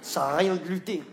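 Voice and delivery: normal talking voice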